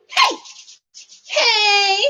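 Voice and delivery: high-pitched hey; calling out. Real, not acted. Vlogger arriving in Salt Lake City, calling out. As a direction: call it out high to the room. Calls greeting to live room.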